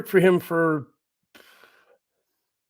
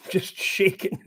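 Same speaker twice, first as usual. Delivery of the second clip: softer, slow, voiceless shaky panting; mimed hyperventilating; 6 dB quieter, slower than usual. Retold or imitated. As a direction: act out panicky breathing between the words.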